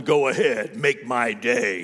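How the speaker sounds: low, slow menacing growl of a movie tough guy; Clint Eastwood. Retold or imitated. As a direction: drop the voice way down and drawl the threat slowly. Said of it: "the divine Clint Eastwood who was just always walking around going".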